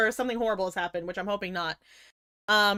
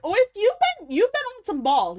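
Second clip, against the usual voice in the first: excited outburst; 9 semitones higher, 4 dB louder than usual. Real, not acted. Exclaiming at a chatter.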